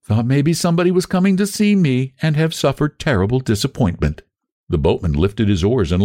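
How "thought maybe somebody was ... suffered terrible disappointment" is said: higher feminine character voice; girlish, teasing complaint; soft-voiced girl. A narrator's reading. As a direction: playfully voice the unseen girl. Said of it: "said the soft voice".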